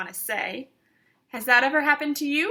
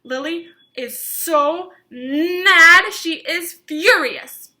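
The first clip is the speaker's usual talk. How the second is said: louder, slow and higher, showing anger; angry emphasis; 5 semitones higher, 8 dB louder, slower than usual. A narrator's reading. Reader acting out how furious Lily is, loudly. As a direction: sound as mad as Lily.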